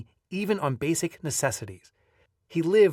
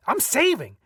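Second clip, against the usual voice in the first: higher, defensive retort; raised-pitch snappy comeback; snapping back; 8 semitones higher, 4 dB louder than usual. A narrator's reading. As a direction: protest indignantly to parents. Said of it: "I remember snapping back".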